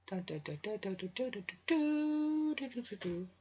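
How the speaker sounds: rising mock suspense jingle; hammed-up suspense music; dramatic dun dun dun. Real, not acted. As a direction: sing dramatic sting, climbing up. Dramatic sound effect voiced.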